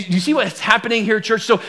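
ordinary talk

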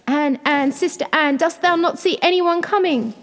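high, urgent call; crying out. A narrator's reading. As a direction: call to your sister with mounting panic. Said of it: "and then she cried".

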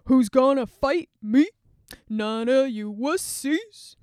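loud jeering giant voice; mock the cowards from across the field; Goliath's loud sneer; giant's taunt. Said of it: "And Goliath every single day".

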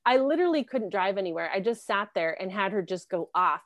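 usual speaking voice

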